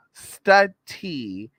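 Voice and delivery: "Study" split as stud-TEE with a hard T; emphatic syllable split. Shown as a demonstration. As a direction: punch out "Study" in two parts, stud-tee. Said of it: "Well, study, but still, study".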